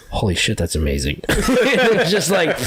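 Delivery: delighted laugh through the words; laughing amazement. Real, not acted. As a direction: crack up at the memory. Retelling first bite of steak, laughing.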